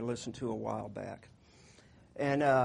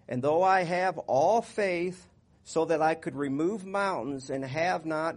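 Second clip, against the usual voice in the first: raised, slow Bible reading; scripture reading; 3 semitones higher, 5 dB louder than usual. Acted. Pastor reading First Corinthians to the church, slow and raised. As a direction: proclaim the love chapter slowly.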